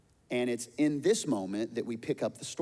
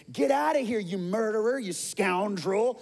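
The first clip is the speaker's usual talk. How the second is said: high, loud furious yell, slowing on the last words; shouting curses; 9 semitones higher, 6 dB louder than usual. Acted. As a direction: hurl the insults at the fleeing king. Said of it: "he shouted at David".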